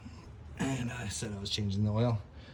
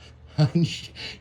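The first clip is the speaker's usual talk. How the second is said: burst of laughter; 6 semitones higher, 5 dB louder than usual. Real, not acted. Giggle after the jack off pun.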